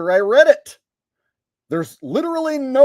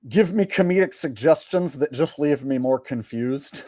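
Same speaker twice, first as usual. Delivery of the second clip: normal talking voice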